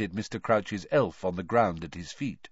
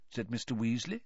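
usual speaking voice

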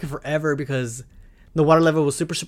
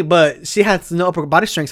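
ordinary talk, nothing marked

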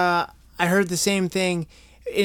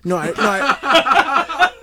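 slow, high, laughing protest; 8 semitones higher, 5 dB louder, much slower than usual. Real, not acted. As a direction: laugh through the denial. Flustered denial, laughing.

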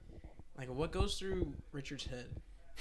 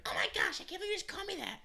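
put-on much higher, shocked girlfriend voice; mock offended Danielle; faster than usual. Danielle reacting to an insult, high mock outrage. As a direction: squeal it like she's scandalized. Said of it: "she'd be like".

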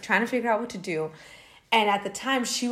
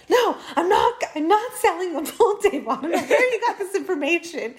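high, louder, laughing mock rant; mock angry old man; 10 semitones higher, 5 dB louder than usual. Imitating the pranked man's furious phone denial, laughing. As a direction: ham up his annoyed denial through laughter. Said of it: "He was so angry".

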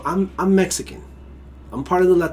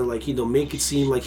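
ordinary talk

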